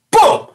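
sudden high, loud boom; explosive boom. Retold or imitated. Storyteller springing the moment on listeners, explosive boom. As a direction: burst out with a bang.

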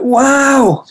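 put-on high squeal of childlike wonder; excited kid wow. Father imitating his son's thrilled reaction, high squeal. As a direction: gasp it like a thrilled eight-year-old. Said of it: "He goes".

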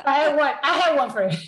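laughing through the words; real laugh while admitting it. Real, not acted. Laughing at own hardship.

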